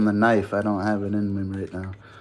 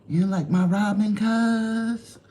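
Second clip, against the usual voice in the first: higher, whiny mock complaint; higher mock hurt; 10 semitones higher, slower than usual. Streamer teasing chat about his Robin, higher pouty voice. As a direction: pout it up high at chat.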